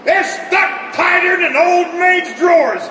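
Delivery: high, strained yelling while forcing the doors; straining shout. Acted. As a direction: shout it out as you heave on stuck doors. Fighting stuck bomb bay doors.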